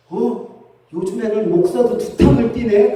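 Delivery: mock drunken astonishment; puzzled drunkard. Drunk spots the same pastor, comically baffled. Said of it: "이분이 한다는 얘기가".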